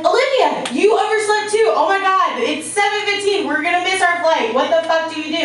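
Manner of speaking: high frantic yelling; panicked shriek. Retold or imitated. Screaming at friend, missing flight.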